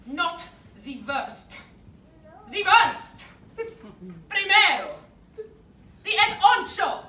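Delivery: loud, high, hammy insistence; indignant shouting. Acted. Captor correcting the prisoner about the worst admiral, hammy shouts.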